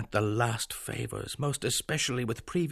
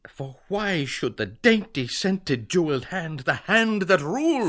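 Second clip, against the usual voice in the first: rising, louder fervour; fervent; 7 semitones higher, 7 dB louder than usual. Acted. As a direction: plead with sudden fervour. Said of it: "With sudden fervour".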